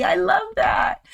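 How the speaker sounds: high, gushing squeal of excitement; excited. Acted. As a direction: burst out with delight at the chat. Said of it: "I'm so excited".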